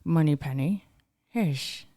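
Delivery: low slushy Scottish impression; rough impression with slurred S's; Sean Connery. Shown as a demonstration. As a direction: put on a low Scottish spy voice. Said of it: "give me your best Sean Connery".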